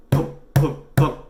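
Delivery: three loud, separate thumps; banging on wall. A narrator's reading. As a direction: voice heavy knocks through a wall. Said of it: "Someone was banging on the wall".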